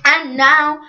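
slow, stretched-out grand announcer delivery; show host announcement. Acted. Host calling for a drumroll before a new contestant, grand announcement. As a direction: draw out each word to build suspense.

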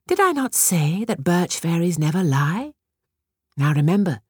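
high fairy giving a gentle lesson; lightly voiced kind fairy; tinkling fairy voice. A narrator's reading. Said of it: "said the birch fairy".

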